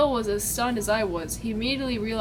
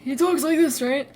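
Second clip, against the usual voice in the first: lightly put-on father voice, raised in pitch; a hint of character, louder and higher; father's character voice; 4 semitones higher, 6 dB louder than usual. Shown as a demonstration. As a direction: try out a stern father's voice. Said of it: "Can't wait to do his voice".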